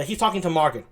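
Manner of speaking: ordinary delivery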